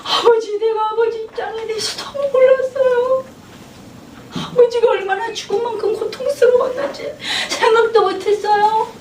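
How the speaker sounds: crying, high-pitched apology to a father; weeping reconciliation; tearful apology. Retold or imitated. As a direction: sob the words out, voice high. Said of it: "하면서 막 우는 거예요".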